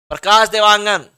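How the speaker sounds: normal talking voice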